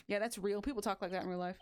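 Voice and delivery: ordinary talk, nothing marked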